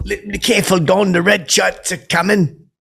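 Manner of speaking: mock British warning. Warning Don about Ben's red shirt, mock British accent. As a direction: sound a mock alarm in put-on British accent.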